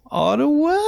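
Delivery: high, squeaky rising question; unsure guess. Retold or imitated. Passenger guessing Canada's capital, high and unsure.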